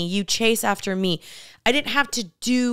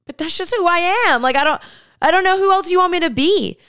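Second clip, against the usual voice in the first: high, raised-voice plea, acted out; hurt pushback replayed at a higher pitch; retold exasperated protest; 9 semitones higher, 5 dB louder, faster than usual. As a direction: protest to a critical partner, pitched way up. Acting her protest to a critical ex.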